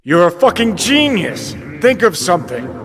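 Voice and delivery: loud, high, biting sarcasm; sarcastic mockery. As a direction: throw her words back with scorn. Mocking V's empty promise.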